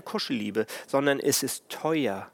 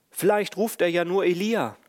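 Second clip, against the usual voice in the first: mocking bystanders; 5 semitones higher, 4 dB louder than usual. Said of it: "und sagen".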